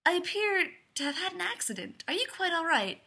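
high-pitched, politely sheepish apology. A narrator's reading. Stranger who fell on Leonard checking on him, sheepish. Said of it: "the man says sheepishly".

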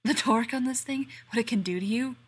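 loud breathless panting. Acted. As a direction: keep panting through the words. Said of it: "Rodney pants".

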